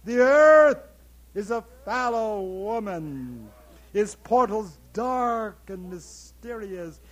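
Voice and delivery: loud, slow, soaring declamation; booming climax. Acted. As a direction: roar the vision to the sky. Monologue climax, booming lines.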